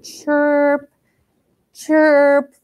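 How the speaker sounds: high chirp like a smoke alarm; imitated chirp. Teacher mimicking the smoke alarm chirp, high and light.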